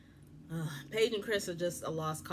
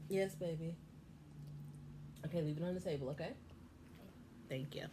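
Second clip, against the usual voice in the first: gentle aside; 10 dB quieter than usual. Real, not acted. Mother quietly telling her child where to leave it, gentle. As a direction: hush it aside kindly.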